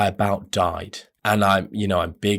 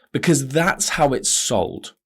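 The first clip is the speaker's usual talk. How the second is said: normal talking voice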